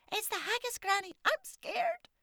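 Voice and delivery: put-on high, loud child's cry of fear; frightened cry. Morag crying out to Granny about the haggis, loud and scared. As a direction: cry out in panic like a scared little girl. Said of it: "And she cried out".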